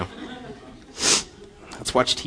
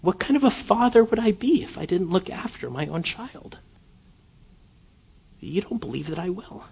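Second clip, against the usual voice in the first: much softer and higher, gentle and fatherly; God speaking tenderly; 8 semitones higher, 6 dB quieter than usual. Retold or imitated. God reassuring his adopted child, softly and tenderly. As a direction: hush down as a loving father. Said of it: "He says".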